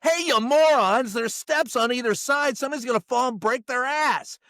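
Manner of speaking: shouting a warning at the climbers; retold yell. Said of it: "I yelled at him".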